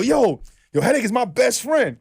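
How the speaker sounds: high bragging fan voice; imitating a fan. Fan on the six train bragging, high mock boast. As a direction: brag high like a stranger on the train.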